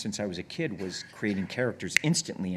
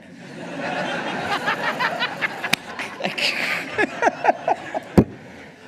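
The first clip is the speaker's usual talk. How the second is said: real belly laugh, much higher; laughing hard, much higher; long high laugh; 7 dB louder than usual. Real, not acted. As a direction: burst out laughing at the memory. Laughing at memory.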